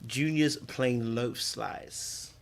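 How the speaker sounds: slow, soft reading of "Junior's Plain Loaf Slice"; slow announcing. Real, not acted. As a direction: read the label slowly. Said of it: "reading product name slowly".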